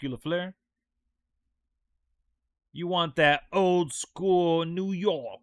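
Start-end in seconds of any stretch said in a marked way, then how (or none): 2.7-5.4 put-on slow, drawn-out 1920s New York gangster accent; loud, slowed-down mock 1920s New York gangster; old New York accent. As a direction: talk like an old-school New York wiseguy, slowly. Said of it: "Throw in the twenties accent".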